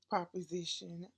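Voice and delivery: usual speaking voice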